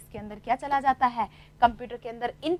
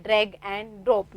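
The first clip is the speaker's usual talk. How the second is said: slow teaching emphasis; 4 semitones lower, much slower than usual. Shown as a demonstration. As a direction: drag and drop drawn out very slowly, word by word. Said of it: "क्या कर सकते हैं". (धीरे-धीरे, हर शब्द पर ज़ोर देकर)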